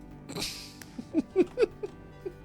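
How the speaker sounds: silent high wheeze laugh; breathless unvoiced giggle; wheezing laugh. Real, not acted. Laughing at yo mama joke.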